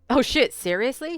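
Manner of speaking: surprised. Acted. Marcus shocked by death news.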